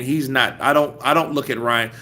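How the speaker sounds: usual speaking voice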